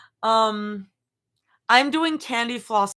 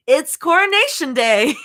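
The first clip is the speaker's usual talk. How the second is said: loud, high excited yell; Anna from Frozen; 8 semitones higher, 8 dB louder than usual. Acted. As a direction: belt it out in giddy imitation. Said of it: "when Anna from Frozen was yelling".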